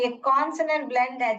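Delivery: normal talking voice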